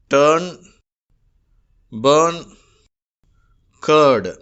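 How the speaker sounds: டர்ன், பர்ன், கர்டு with the u before r as a long er; ur sound demo. Shown as a demonstration. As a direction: model each word with an er sound. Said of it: "R என்ற எழுத்து வரும்பொழுது". (யூ எழுத்துக்குப் பின் R வரும் அ ஒலி)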